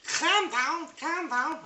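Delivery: very high, loud Scouse squawk; hammed-up Scouse, pitched sky-high; Scouse catchphrase. Shown as a demonstration. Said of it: "is known as Scouse".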